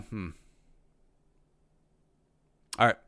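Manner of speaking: usual speaking voice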